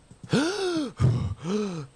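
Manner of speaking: big high revival gasp; sharp, high-pitched gulp of air; gasping awake. Acted. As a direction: gasp back to life. Revived character comes back with gasp.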